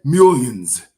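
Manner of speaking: a loud, scoffing "Millions"; sarcastic echo of what they say; mocking the official claim. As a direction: throw their number back at them with disdain. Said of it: "they say?".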